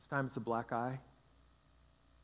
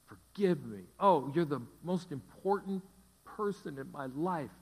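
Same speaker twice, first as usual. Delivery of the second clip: higher, louder plea; begging husband; 3 semitones higher, 5 dB louder than usual. Retold or imitated. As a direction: plead with her as if in tears. Husband weeping, confessing.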